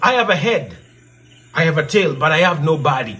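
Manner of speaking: normal talking voice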